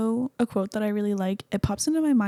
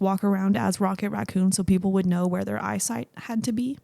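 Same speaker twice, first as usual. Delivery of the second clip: normal talking voice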